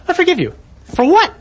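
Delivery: baffled disbelief. Pretend. A stranger forgives him, he asks back in high disbelief. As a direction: sound thrown by a stranger's forgiveness.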